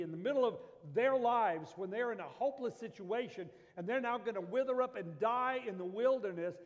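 impassioned sermon climb; preaching at full force. Acted. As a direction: drive it home harder with each phrase. Preacher builds in intensity.

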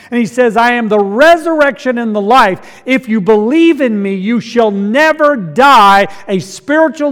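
full-force preaching. Acted. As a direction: proclaim it to the whole church. Preaching eternal life.

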